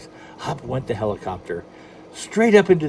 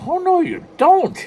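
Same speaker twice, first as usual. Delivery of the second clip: high, loud leprechaun cry; a tiny leprechaun balking in alarm; 8 dB louder than usual. Acted. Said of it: "he cried in alarm".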